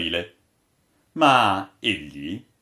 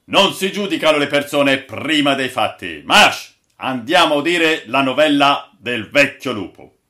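loud, raised voice of command; imperious captain; 5 semitones higher, 9 dB louder than usual. A narrator's reading. Captain scolds and marches off the crew, imperiously. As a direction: order the men about firmly. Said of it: "con tono imperioso".

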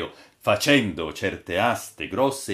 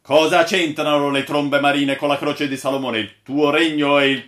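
loud, raised challenge; interrupting objection; 6 semitones higher, 7 dB louder than usual. A narrator's reading. Captain interrupts the old sailor's tale, loudly objecting. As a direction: break in and demand an explanation. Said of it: "disse il capitano interrompendo".

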